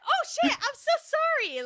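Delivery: very high, squeaky fake apology; high mock oops; mocking Yara. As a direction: squeal a guilty sorry. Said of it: "She's like".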